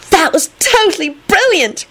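very high, awed gush; high-pitched admiration; admiring. A narrator's reading. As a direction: praise it like a thrilled fan. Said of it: "Rosie said admiringly".

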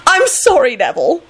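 high, bubbly apology; gleeful and high-pitched; happy squeal. A narrator's reading. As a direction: squeal it, not sorry at all. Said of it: "Elizabeth squealed happily".

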